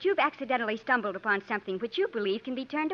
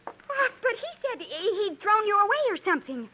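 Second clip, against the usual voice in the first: astonished; 7 semitones higher than usual. Acted. Sandal sees Andy alive after Jim's story, astonished. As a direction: caught off guard, stumbling.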